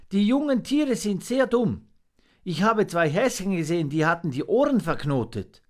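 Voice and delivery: high, quick creature chatter; little monkey, eager. A narrator's reading.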